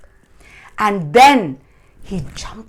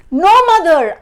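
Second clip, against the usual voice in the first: high, loud urgent refusal; shouted protest; 11 semitones higher, 12 dB louder, faster than usual. A narrator's reading. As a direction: yell back down in haste. Jack shouts back from beanstalk.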